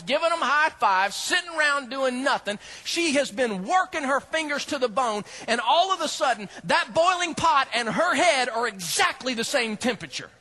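ordinary talk

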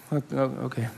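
small, halting comic voice; mock nervous. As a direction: stammer agreement, playing intimidated. Intimidated reply to friend.

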